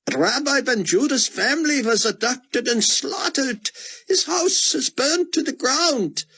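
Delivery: put-on high, anxious old scholar voice; pleading old man. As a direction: beg as a frightened old man. Elderly Israeli scholar pleading.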